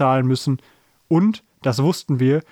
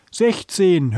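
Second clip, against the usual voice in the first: ordinary delivery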